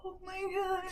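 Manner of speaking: high, hushed shocked gasp; hushed high mom shock. Retold or imitated. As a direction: gasp it out in disbelief. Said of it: "And I was like".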